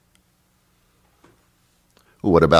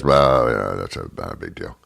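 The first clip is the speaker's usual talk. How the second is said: low mumbled brush-off, fading away; Trump; 11 semitones lower, 4 dB quieter than usual. Acted. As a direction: shrug it off in a very low, soft mumble. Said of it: "So Trump says".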